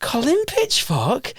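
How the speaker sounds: high, slow questioning repeat of COLIN PITCHFORK; playful incredulity. Retold or imitated. MC repeating the detective's answer, playful high incredulity. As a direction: echo the name in mock disbelief, rising. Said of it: "in one of my little playful M C roles".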